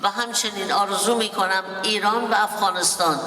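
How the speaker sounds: ordinary talk, nothing marked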